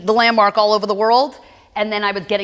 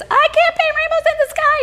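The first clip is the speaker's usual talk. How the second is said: high shrill mock of mom screaming; mother screaming. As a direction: imitate her mother's high-pitched yell. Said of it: "And she screams".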